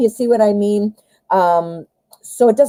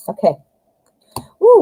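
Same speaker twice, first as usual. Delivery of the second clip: usual speaking voice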